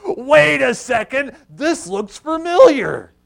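high, loud, drawn-out double take; dawning recognition. Acted. Preacher acts out Peter recognizing the moment, high drawn-out realization. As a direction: stretch it out as realization hits.

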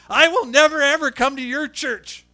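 loud, high-pitched mock outrage; indignant scolding. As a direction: scold like an offended churchgoer. She shakes her finger at me.

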